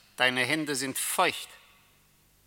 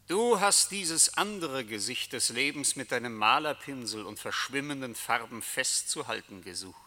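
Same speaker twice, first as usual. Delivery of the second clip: ordinary talk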